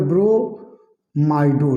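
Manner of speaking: ब्रोमाइडो very slow, broken into long syllables; drawn-out term. Shown as a demonstration. Teacher repeating the ligand name for students, slowly drawn out. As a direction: stretch ब्रोमाइडो part by part. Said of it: "क्या हो जाएगा?".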